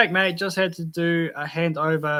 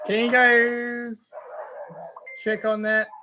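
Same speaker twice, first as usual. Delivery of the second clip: higher, drawn-out call to someone away; 6 semitones higher, slower than usual. Real, not acted. Streamer asks someone to check on the dogs, calling out. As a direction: call off-mic, slow and raised in pitch.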